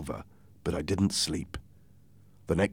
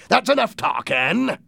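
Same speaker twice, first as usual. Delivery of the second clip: high, raised pirate shout; shouted order; 13 semitones higher, 4 dB louder than usual. Acted. Silver breaking up the talk, shouting. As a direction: cut the chat short with a high call. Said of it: "Silver shouted".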